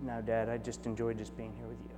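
soft, low and gentle, imitating a contented child; hushed, tender quote; tender reply. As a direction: quietly retell a daughter's sweet answer to Dad. Voicing young daughter's tender reply.

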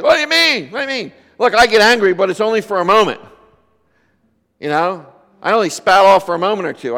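high, loud protesting counselee; defensive retort. Retold or imitated. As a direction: imitate an angry man brushing it off, pushing fast. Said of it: "so the guy said".

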